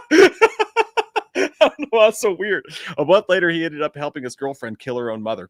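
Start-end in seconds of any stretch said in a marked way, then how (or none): none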